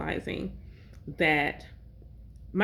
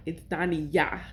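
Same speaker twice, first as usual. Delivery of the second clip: Dani Yah with the last syllable emphasized; stress on Yah. Shown as a demonstration. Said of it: "the emphasis is on Yah".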